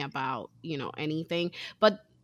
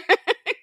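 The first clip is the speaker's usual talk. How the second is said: high burst of laughter; 14 semitones higher, 6 dB louder than usual. Real, not acted. Laughs at own dodge.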